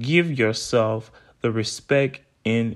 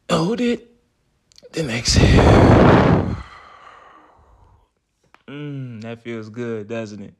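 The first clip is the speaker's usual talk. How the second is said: guided meditation. Acted. Leads a breathing exercise.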